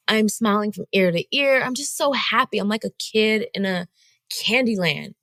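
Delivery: ordinary talk, nothing marked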